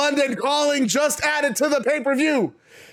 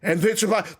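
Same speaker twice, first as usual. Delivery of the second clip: normal talking voice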